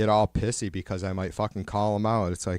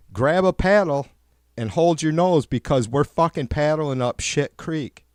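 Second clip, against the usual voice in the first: loud, higher, hammering it home; loud rant; 5 semitones higher, 5 dB louder than usual. Real, not acted. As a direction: bark orders at the passengers. Continues shouted boat rant.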